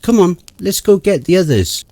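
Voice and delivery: urgent call. Acted. Urgent whisper-wake in camp.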